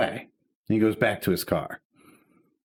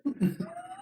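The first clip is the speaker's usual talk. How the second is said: high squeaky laugh; 8 dB quieter than usual. Real, not acted. Laughing at absurd cop question.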